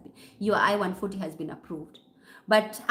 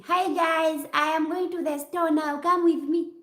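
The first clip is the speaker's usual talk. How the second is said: high, louder video intro voice; vlogger greeting; 7 semitones higher, 4 dB louder than usual. Acted. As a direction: call out brightly to your followers. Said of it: "I video and say".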